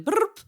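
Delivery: rolled cat chirrup, "brrrp"; cat's waking trill. Retold or imitated. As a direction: imitate a cat trilling as it wakes. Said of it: "like cats when they do a little".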